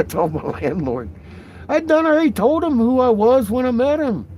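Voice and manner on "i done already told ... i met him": high, loud bragging; loud boast. Real, not acted. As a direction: crow about it loudly. Boasting loudly.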